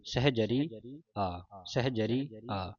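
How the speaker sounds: ਸਹਿਜਰੀ ਆ said very slowly and softly; ਸਹਿਜਰੀ then a soft separate ਆ, drawn out; slow, soft, careful ਸਹਿਜਰੀ ਆ; slow, careful reading. Shown as a demonstration. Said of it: "ਮਤਲਬ ਕਿ ਪਾਠ ਇਕੱਠਾ ਹੈ".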